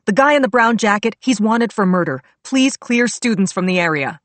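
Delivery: loud urgent shout to nearby cops. A narrator's reading. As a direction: call out the warning loudly. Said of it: "I flashed my badge and shouted".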